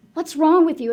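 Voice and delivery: imitating an upset boyfriend; exasperated confrontation. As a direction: demand it of someone you can't understand. Boyfriend shaking her, acted quote.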